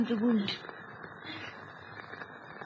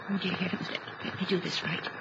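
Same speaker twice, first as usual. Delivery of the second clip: soft, lower, trembling prayer to herself; a frightened wife praying before she cuts; hushed prayer; 8 semitones lower, 11 dB quieter than usual. Acted. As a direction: pray under her breath. Praying alone before cutting.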